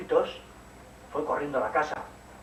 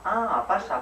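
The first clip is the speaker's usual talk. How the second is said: a higher, louder welcome; mother's welcome; 6 semitones higher, 6 dB louder, much slower than usual. Acted. Mum in bed invites Caperucita in, higher. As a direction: mum greets her girl brightly. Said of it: "Y dijo mamá".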